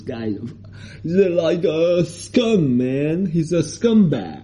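slowly voiced English grumble; slow mock complaint in English. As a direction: stretch out each word like a stranded tourist. Acting out his English complaint.

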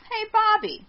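high, mock-chummy character voice; put-on Mick voice. Neighbor asks Bobby about his better half, high put-on voice. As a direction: play the neighbor greeting his pal in high pitch.

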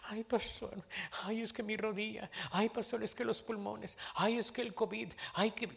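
soft mock whimpering complaint; a hint of a whiny old parishioner; mock whining churchgoer. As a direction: whine about aches like a fussy believer. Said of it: "se ponen a llorar y a chillar".

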